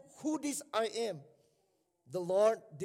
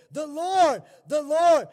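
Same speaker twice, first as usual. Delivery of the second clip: proclaiming shout; 6 semitones higher, 13 dB louder, slower than usual. Acted. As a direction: ring out "The Lord" loud. Proclaiming God's name.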